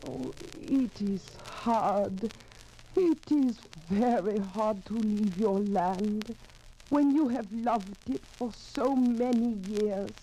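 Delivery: slow, high, grieving farewell; sorrowful lament. Acted. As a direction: mourn the land you must leave, slowly. Wife grieving leaving her land.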